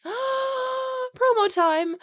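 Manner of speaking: sung-out high promo call; announcer jingle. Acted. Sudden self-promo announcement.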